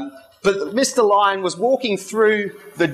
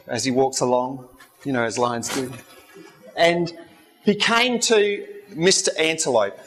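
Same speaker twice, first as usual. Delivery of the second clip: usual speaking voice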